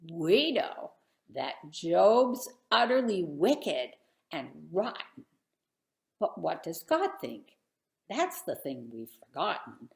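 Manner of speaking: lively sing-song storybook reading; rhythmic picture book read-aloud; reading a rhyming story aloud. Acted. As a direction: read the verses to a room of kids. Reads rhyming Job picture book.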